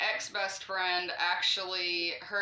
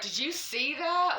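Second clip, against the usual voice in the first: retold freaked-out question; 7 semitones higher than usual. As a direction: replay the panic of the moment. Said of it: "Like, I'm freaking out".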